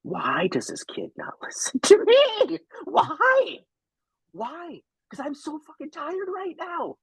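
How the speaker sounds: high, strained plea of a worn-out parent; mock exasperated moaning; exhausted dad's lament. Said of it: "I was like".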